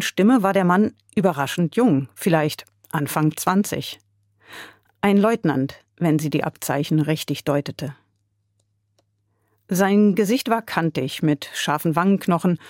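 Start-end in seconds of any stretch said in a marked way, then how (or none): none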